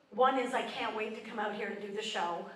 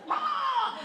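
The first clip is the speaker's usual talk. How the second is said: sudden high gasp with a laugh; startled wake; 4 dB louder than usual. Retold or imitated. Waking at three am, startled high yelp. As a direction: jolt awake with a high yelp. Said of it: "you're just like".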